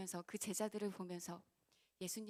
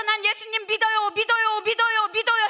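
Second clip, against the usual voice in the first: mock insistent claim; 12 semitones higher, 22 dB louder than usual. Mocking believer's empty claim.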